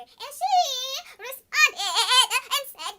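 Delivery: ordinary delivery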